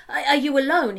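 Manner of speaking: a hurried first check for survivors; asked quickly. Acted. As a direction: blurt it out fast, worried. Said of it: "he asked quickly".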